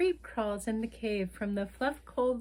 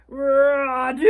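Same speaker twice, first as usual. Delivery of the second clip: big sneeze; 4 semitones higher, 14 dB louder than usual. Acted. Acting the bear's sneeze for kids.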